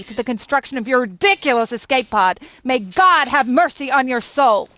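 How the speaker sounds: loud, heated rant building through a list; angry tirade. Acted. Shopkeeper listing all the damage done to the home, furious. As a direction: let rip with mounting outrage.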